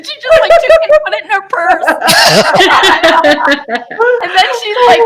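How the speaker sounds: laughing out loud, high and loud; can barely get it out through laughter. Real, not acted. Telling the grandma story while cracking up, laughing. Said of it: "laughing while telling".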